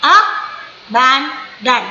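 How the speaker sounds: abandon very slow, a... ban... dan; three separate stresses. Shown as a demonstration. Teacher showing the jaw drop thrice, abandon split slowly. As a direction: break abandon into three spaced beats. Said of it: "तीन बार आपका jaw line नीचे होगा".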